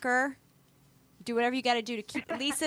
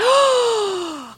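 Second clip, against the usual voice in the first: a high, sudden gasp of delight; sharp gasp; 11 semitones higher, 7 dB louder than usual. Real, not acted. Friend hears she's reading Pachinko, sharp delighted gasp. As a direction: gasp out loud in surprise. Excited news about Pachinko.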